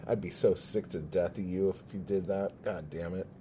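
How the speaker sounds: normal talking voice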